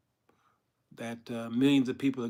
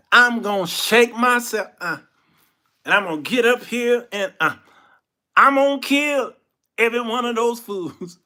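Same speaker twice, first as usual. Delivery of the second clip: loud, high strongman threat with grunts; hammed-up hero gearing up for battle; boastful Samson; 10 semitones higher, 10 dB louder than usual. Boastful Samson acted before fight.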